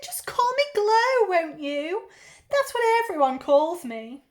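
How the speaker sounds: loud, high auntie voice; big, high-pitched aunt; effusive aunt. Acted. Aunt introduces herself.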